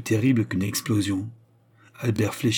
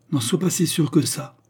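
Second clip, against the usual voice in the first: feebly combative; 7 semitones higher, 3 dB louder than usual. A narrator's reading. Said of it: "dans une velléité combative".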